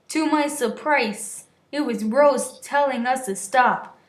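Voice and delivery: plain reading voice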